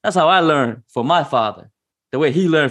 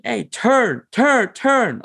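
urgent repeated yell; 9 semitones higher, much slower than usual. Retold or imitated. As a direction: shout it faster each time. Yelling at brother on bike.

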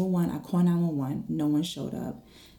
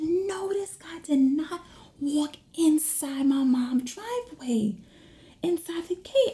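high-pitched, drawn-out disbelief; incredulous at the intruder, volume held in; retold disbelief; 10 semitones higher than usual. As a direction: replay your alarm, pitch way up. Retelling her alarm at intruder.

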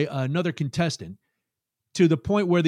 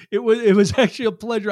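laughing; 6 semitones higher, 6 dB louder, faster than usual. Real, not acted. Laughs after sarcastic remark.

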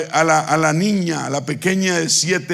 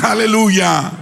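raised, higher preacher's hallelujah; congregational call; 4 semitones higher, 4 dB louder than usual. Real, not acted. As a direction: lead the crowd in a hallelujah.

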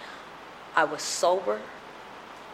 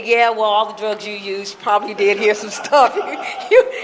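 louder, laughing scorn of doubters; scoffing skeptic laughing it off; mocking laugh; 2 semitones higher, 6 dB louder than usual. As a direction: chuckle at the story as a drug dream. Mocking skeptics who doubt him.

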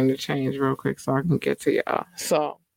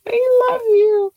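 much higher, slow, louder sing-song; high gushing; 5 dB louder than usual. Acted. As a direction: gush it in a high squeaky voice. After laugh, gushing to fans.

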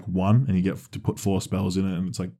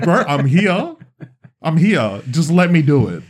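higher, louder, laughing plea; mock pleading; 7 semitones higher, 6 dB louder, slower than usual. Begging the game to let him play Quidditch, a laughing plea. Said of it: "I was like".

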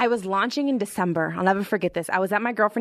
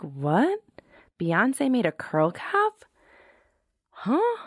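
stunned disbelief; 4 semitones higher, much slower than usual. Retold or imitated. Retelling shock at [name]'s hat.

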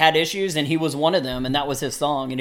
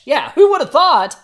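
surprised; 11 semitones higher, 7 dB louder than usual. Real, not acted. Marveling at Hanson with Phish.

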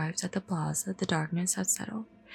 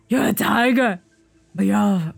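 forceful tamer's shout; villain's loud challenge; loud accusation; 11 dB louder than usual. Acted. Tamer's accusation read again.

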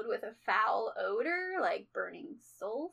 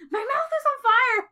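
mock shout; 12 semitones higher, 9 dB louder than usual. Voicing hellhound with burning mouth.

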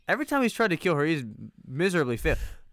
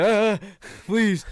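high, loud desperate plea; begging for my life; 9 semitones higher, 12 dB louder than usual. Retold or imitated. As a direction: beg for mercy in a panic. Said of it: "You start seeing him beg for his life".

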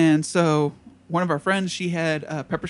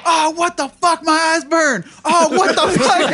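high, loud mock cry of pain; hammed-up shout of burning eyes; panicked yell; 11 semitones higher, 7 dB louder than usual. Partygoers hit by pepper spray, high panicked yelling.

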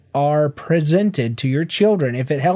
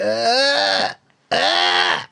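put-on higher, drawn-out baby "ah"; imitated baby fussing; 7 semitones higher than usual. Dad imitating his son slipping down in the stroller, baby fussing. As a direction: mimic the baby's wordless complaint. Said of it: "only thing he knows how to say".

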